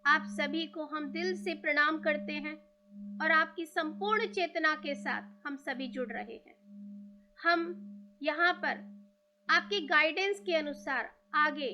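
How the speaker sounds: guided meditation. Real, not acted. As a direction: ease them into stillness, leaving room to breathe.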